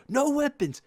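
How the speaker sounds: high, loud angry yell; imitated coach yell. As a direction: bark it at the kids who missed the order. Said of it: "so he yells at us".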